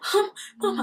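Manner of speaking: high, choked mock call to mum; unable to talk. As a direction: reenact struggling to get words out. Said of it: "I couldn't even talk".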